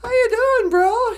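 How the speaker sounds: high, loud skunk hello; delighted greeting. Acted. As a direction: greet a dear friend, overjoyed to see him. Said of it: "He's just happy to see him".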